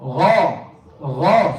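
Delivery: "Gha" as a full, heavy throat sound. Shown as a demonstration. Teacher models Gha for students, heavy gha. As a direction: make Gha heavy, with an open back vowel. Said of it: "Gotta make it heavy".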